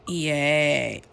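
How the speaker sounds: loud, excited hostess running a live draw; live giveaway host. Acted. Vlogger to her viewers during the prize draw, loud and excited. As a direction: hype the viewers through the spin.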